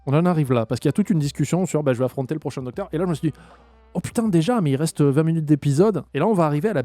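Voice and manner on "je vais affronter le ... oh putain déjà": normal talking voice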